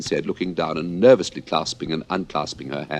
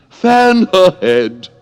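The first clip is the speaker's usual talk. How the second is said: high, loud, very slow urgent command; anxious Red Queen; 14 semitones higher, 12 dB louder, much slower than usual. Acted. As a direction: cry out in alarm for her to be fanned. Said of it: "the Red Queen anxiously interrupted".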